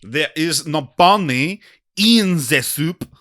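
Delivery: lightly done French waiter; mild French waiter accent; thick French accent. Acted. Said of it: "The waiter has a very thick French accent".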